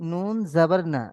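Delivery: usual speaking voice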